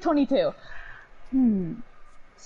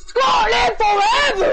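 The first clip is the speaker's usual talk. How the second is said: high, loud, drawn-out yell; mock-heroic scream at full force; battle cry; 11 semitones higher, 6 dB louder than usual. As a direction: bellow a hammed-up Scottish war cry. Mock battle cry after accent bit.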